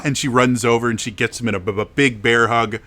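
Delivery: ordinary talk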